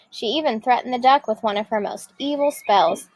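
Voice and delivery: plain reading voice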